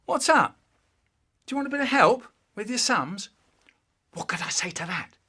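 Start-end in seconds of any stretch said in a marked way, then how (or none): 0.0-3.3 lightly voiced older brother, higher and brighter; a hint of a friendly big brother; brother's voice. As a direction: offer help a bit too cheerfully. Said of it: "he says".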